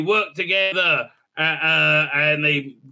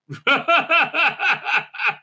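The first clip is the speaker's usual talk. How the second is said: high laugh; 10 semitones higher, 3 dB louder than usual. Real, not acted. Laughing at own joke.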